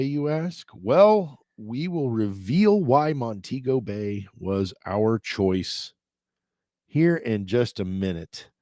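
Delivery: ordinary talk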